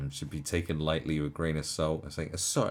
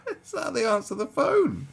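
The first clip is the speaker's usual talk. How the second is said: laughing. Real, not acted. Reactor jokes about comrades on the phone, laughing hard. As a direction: barely get it out while cracking up. Talking through a laughing fit.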